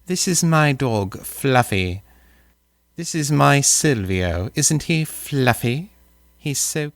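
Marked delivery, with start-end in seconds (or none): none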